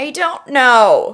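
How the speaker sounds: put-on high, pleading frightened girl; 911 caller. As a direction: beg for help, panicked. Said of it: "I am going to be Melissa".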